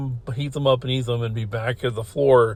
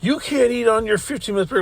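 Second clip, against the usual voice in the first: imitated frantic objection, much higher; frantic panic; 10 semitones higher than usual. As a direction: mimic a panicking manager, voice pitched way up. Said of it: "in a frantic, like, panic".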